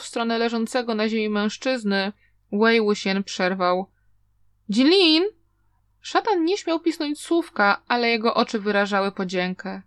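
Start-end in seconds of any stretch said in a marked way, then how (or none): 4.7-5.2 calling out. A narrator's reading. Calling out to stop him.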